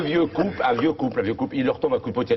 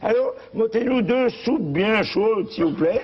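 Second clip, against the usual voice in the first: put-on old man on phone; 9 semitones higher, slower than usual. Ordering soup by phone.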